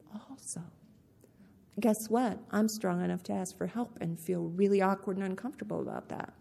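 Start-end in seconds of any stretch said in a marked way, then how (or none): 0.1-0.6 a tiny whispered also; whispered aside. Real, not acted. To the audience, a whispered playful aside. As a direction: breathe the word as a secret.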